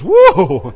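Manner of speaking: loud exaggerated gasp of a healed girl; a big hammed-up cry of amazement; mock whoa. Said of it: "the little girl goes".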